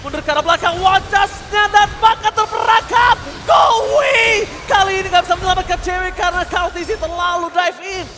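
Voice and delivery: high, loud, slowed call; excited shout. Real, not acted. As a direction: yell the trap like a caster. Caster calls chase and kill.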